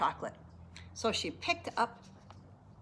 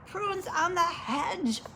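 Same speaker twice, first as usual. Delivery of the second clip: put-on high, drawn-out kids' call; mild excited shout, stretched slowly; children's shout; 8 semitones higher, much slower than usual. A narrator's reading. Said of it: "they shouted".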